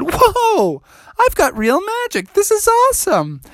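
very high squeaky amazement; thrilled at his own magic; amazed frog. Acted. Froggy amazed.

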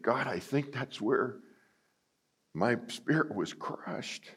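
soft, slow inner thought; quiet realization. Retold or imitated. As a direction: voice it as a hushed discovery.

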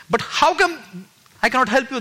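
high-pitched, incredulous echo; bewildered questioning. Retold or imitated. Puzzled reaction to the voice's words.

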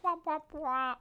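womp womp, sad trombone. Shown as a demonstration. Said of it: "What's the noise?".

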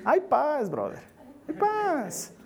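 breezy comfort from someone with an easy life; laid-back reassurance. Acted. Pastor imitating a comfortable preacher telling a brother to relax, breezy. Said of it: "te dice, "Tranquilo".

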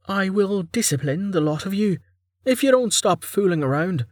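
high voice, irritation peeking through; mildly irritated, composed warning. A narrator's reading. Vanna warns her crew to stop fooling, irritated. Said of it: "startling degree of irritation peeking through".

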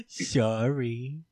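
drawn-out mock sorry; milk the fake apology; air-quote apology. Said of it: "air quotes".

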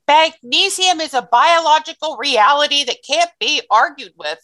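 imitating an adamant correction; emphatic retort. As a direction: quote her setting the doubters straight. Quoting doctor's retort to doubters.

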